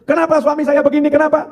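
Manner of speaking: high-pitched, plaintive wifely complaint; complaining wife. Acted. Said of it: "Sama juga istri begitu". (keluhan istri bernada tinggi)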